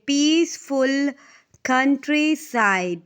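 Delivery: slow, loud पीस-फुल कन्-ट्री-साइड; spaced-out, emphatic पीसफुल कन्ट्रीसाइड; syllable by syllable. Shown as a demonstration. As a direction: break पीसफुल कन्ट्रीसाइड into separate beats. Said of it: "तो इसमें कितने syllables हो गए".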